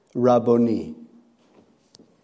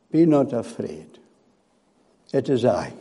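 softer, lower, a tender hush; calm the frightened with a hushed promise; gentle reassurance; 3 semitones lower, 7 dB quieter than usual. Acted. Jesus gently reassuring his frightened disciples, soft and low.

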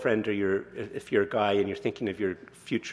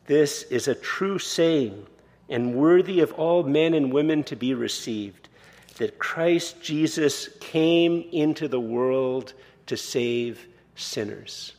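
higher, slow, measured proclamation; solemn slow scripture reading; 4 semitones higher, 4 dB louder, slower than usual. Acted. Preacher reads Paul to congregation, slow solemn proclamation. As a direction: lift each phrase slowly like a holy text.

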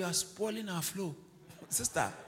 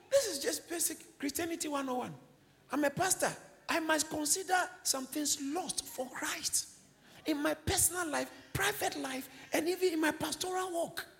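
high-pitched, insistent sermon delivery; preacher in full flight, pitched high; impassioned preaching; 10 semitones higher than usual. Real, not acted. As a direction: press the point home from the pulpit.

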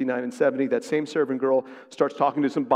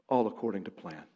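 soft echo; 7 semitones lower, 8 dB quieter, faster than usual. Real, not acted. Quiet echo after pause.

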